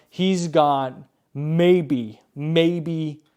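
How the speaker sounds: slow, hesitant build-up on maybe; drawn-out maybe. Real, not acted. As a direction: stretch the maybe out slowly, stalling. Stalling before a number.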